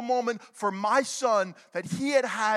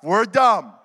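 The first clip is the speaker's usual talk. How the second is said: loud, emphatic declaration; full-voiced crowd prompt; 3 semitones lower, 8 dB louder than usual. Shown as a demonstration. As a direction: boom it out for the room to echo. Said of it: "You can say it loud".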